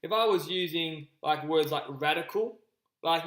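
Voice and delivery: ordinary talk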